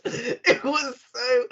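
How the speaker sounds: words broken by laughter; laughing. Real, not acted. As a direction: laugh your way through it. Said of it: "laughing through it".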